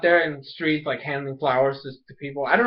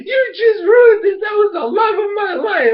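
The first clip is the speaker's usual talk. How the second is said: hammed-up tantrum, far above your own voice; mock heartbroken outburst; 6 dB louder than usual. [name] to Ikki who ruined his romance, hammed-up wail.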